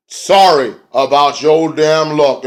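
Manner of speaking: hammed-up mock apology; imitated catchphrase. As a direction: draw it out like a wrestler's entrance line. Imitates wrestler's entrance catchphrase.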